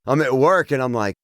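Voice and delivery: usual speaking voice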